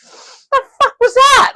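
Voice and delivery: slow, high, loud question through laughter; laughing disbelief. Retold or imitated. Passenger laughing at the fishtail landing, high loud laughing question.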